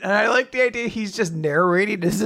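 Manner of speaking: ordinary delivery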